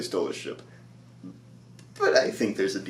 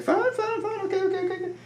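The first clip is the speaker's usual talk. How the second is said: much higher, squeaky hurried alien gibber; Jawa voice; faster than usual. Retold or imitated. Jawas caught by Mando, squeaky panicked backing off. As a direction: chatter it like a panicked little scavenger.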